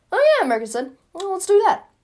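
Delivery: very high, loud burst; eager agreement. Acted. America takes up Douglas's idea, eager agreement. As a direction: pipe up high with sudden enthusiasm.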